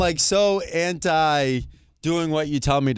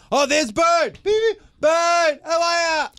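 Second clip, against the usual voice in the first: much higher, mock calling out from a passing car; imitated excited hailing; slower than usual. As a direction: holler like a fan spotting a local celebrity. Imitates passing drivers greeting him.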